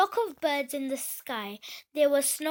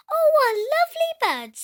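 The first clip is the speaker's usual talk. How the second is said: put-on much higher, much louder delighted girl; little girl; 12 semitones higher, 11 dB louder than usual. A little girl seeing the swans, squealing with delight. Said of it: "said a girl".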